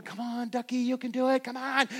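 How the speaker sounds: high, cheering coax to a duck. Retold or imitated. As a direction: urge the little duck on, voice lifted. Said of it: "you're going".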